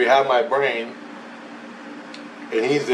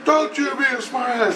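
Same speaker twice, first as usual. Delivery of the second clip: retold yelling; 12 semitones higher than usual. The voice scolding him for backtalk, mock yelling. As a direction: snap back in a high-pitched imitation. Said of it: "he starts yelling at me".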